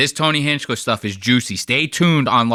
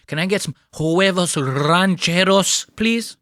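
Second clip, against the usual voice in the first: hammed-up Spanish pronunciation, ending high; mock Spanish accent; 4 semitones higher than usual.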